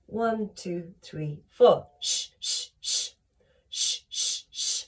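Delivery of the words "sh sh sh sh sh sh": quiet unvoiced sh pulses; soft sh pulses. Shown as a demonstration. Coach leading two rounds of sh, soft pulses. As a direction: push out short puffs of sh. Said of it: "two lots of sh".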